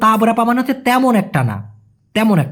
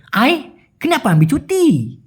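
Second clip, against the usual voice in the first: higher, slow Malaysian slang with stretched ending; Malaysian slang drawl; 5 semitones higher, slower than usual. Retold or imitated. Senior questioning worker's leave, in Malaysian drawl. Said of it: "ওদের স্ল্যাংয়ে বা ওদের টানে".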